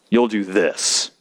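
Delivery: low, slow ultimatum; mock pressuring. Preacher voices boyfriend pressuring the girl, low and insistent. End of boyfriend's selfish demand.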